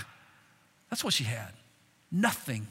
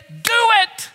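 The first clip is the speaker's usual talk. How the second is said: urgent command; 12 semitones higher than usual. Acted. Preacher urging the widow.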